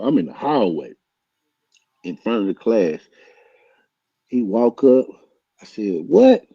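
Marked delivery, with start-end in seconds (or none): 6.1-6.4 high, loud What; challenging shout. Retold or imitated. Teller confronting the rival at the door, loud challenge. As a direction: bark it as a dare. Squaring up in the hallway.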